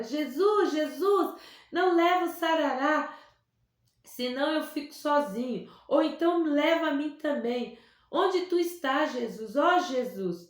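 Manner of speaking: chamado alto e chorado, mais agudo; clamando alto. A narrator's reading. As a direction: clame por Jesus entre lágrimas. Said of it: "Elias chorava alto e clamava". (menino chora alto pedindo a Jesus pelo amigo)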